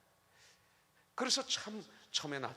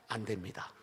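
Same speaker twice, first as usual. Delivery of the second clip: lightly voiced, lower-pitched official refusal; imitated firm secretary; 7 semitones lower than usual. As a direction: play a secretary politely stonewalling. Secretary refuses wife a second time. (단호한 비서를 살짝 흉내 내며)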